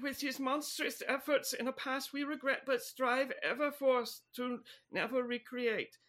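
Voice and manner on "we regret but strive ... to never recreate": usual speaking voice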